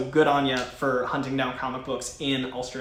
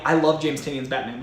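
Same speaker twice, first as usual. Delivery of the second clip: ordinary delivery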